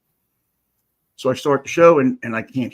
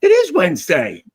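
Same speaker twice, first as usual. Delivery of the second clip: sly excited; 14 semitones higher, 6 dB louder than usual. Acted. Gleeful hint at Whip It Out Wednesday.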